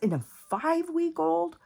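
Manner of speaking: jumps way up high in shocked disbelief; can't believe a mother would do that; incredulous squeak. Real, not acted. Venting to viewers about the drunk mom, squeaking way up in disbelief.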